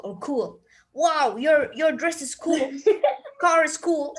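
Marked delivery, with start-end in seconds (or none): 0.9-2.7 casual slang. Shown as a demonstration. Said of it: "This is what we call s-, slang". 2.8-3.3 a quick, high, amused laugh; short high giggle; high burst of laughter. Real, not acted. Laughs at her own slang demo. 3.4-4.1 casual slang. Shown as a demonstration. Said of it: "This is what we call s-, slang".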